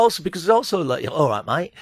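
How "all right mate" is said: hammy Cockney geezer voice; laughing through a Cockney bloke; Cockney accent. Pretend. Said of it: "Do your Cockney accent again".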